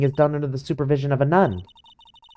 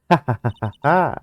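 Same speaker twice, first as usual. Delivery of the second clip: staccato mocking ha-ha; teasing laugh. Maya laughs at Nick's crush, teasing. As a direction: laugh at a friend's crush in separate beats.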